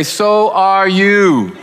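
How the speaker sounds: 隣の人にゆっくり言う; slow, drawn-out "so are you". Shown as a demonstration. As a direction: stretch "So are you" slowly. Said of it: "turn to your other neighbor and say".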